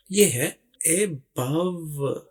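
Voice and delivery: above said slowly, a-BOVE with a clear a; teacher sounds out above slowly; slow careful above. Shown as a demonstration. Teacher introducing a new word, above drawn out slowly. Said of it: "इसमें ए को इलादा से बोला जाता है".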